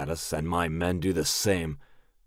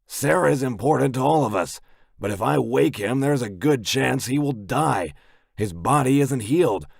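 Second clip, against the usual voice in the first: tense doctor; 7 semitones higher, 4 dB louder than usual. A narrator's reading.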